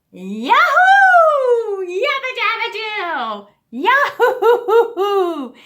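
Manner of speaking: high, very loud cheering with a laugh; joyful yell. Shown as a demonstration. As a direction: whoop it out like a cartoon caveman. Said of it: "and yell".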